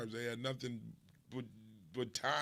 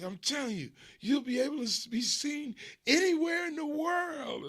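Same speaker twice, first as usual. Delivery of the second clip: much higher, louder pitch rising to a near squeal; excited cousin; 7 dB louder than usual. Retold or imitated. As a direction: insist like it's the obvious future. Cousin insisting on worldwide reach.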